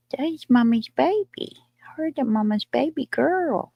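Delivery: high, doting coo. Real, not acted. Baby-talking to her dog.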